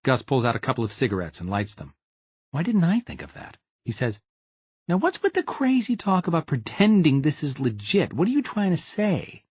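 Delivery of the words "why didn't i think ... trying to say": a slightly higher, friendly buddy voice; lightly voiced Gus. A narrator's reading. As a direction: play Gus as a pushy but friendly pal.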